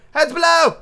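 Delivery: high, loud call of alarm; urgent acted shout from up high; warning shout. A narrator's reading. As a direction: yell a warning to those underneath.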